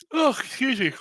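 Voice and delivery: talking through a yawn; words stretched by a big yawn. Real, not acted. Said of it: "Ah, yawning".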